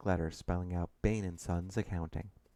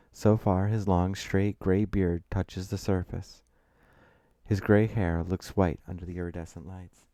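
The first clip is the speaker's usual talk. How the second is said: ordinary delivery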